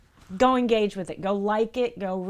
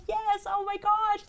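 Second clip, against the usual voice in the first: much higher, thrilled squeal; excited squeal; 13 semitones higher than usual. Retold or imitated. Fan cheers the big donation with a high excited squeal. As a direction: squeal with delight. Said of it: "I was so excited".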